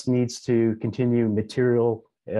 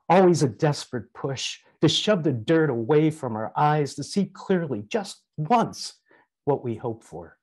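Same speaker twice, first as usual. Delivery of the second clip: put-on raised, impassioned reading of a grieving young woman's lament; dramatic soliloquy reading; 4 semitones higher than usual. Reading Samosa's soliloquy aloud to the gathering, impassioned and declamatory. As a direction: declaim it as Samosa mourning the world's madness. Soliloquy continues after stage direction.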